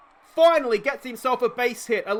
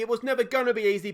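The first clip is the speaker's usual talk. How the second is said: ordinary delivery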